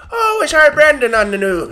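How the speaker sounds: high, laughing Irish mammy; lightly voiced proud mother, giggling; mock family voice. Mocking Brendan's family watching the news, high and laughing.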